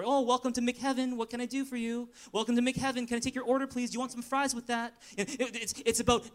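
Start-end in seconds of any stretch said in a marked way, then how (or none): 0.0-4.9 high, chirpy fast food cashier; drive thru speaker. Retold or imitated. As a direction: play a perky drive-thru clerk. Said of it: "takeout counter relationship".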